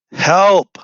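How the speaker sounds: high, loud desperate cry; sobbing scream. A narrator's reading. Narrator choking on feathers, screaming for help. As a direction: cry out for rescue. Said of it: "I sob and scream".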